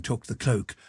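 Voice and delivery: ordinary delivery, nothing marked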